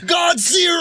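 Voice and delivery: high-pitched mock shout down the plane; mock calling out. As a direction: call out high to the back of the plane. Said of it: "look at the back of the plane and be like".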